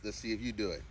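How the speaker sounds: normal talking voice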